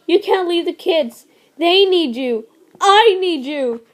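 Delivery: mate crying out at her deathbed; desperate begging. Acted. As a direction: beg her not to die. Mate begs dying mate.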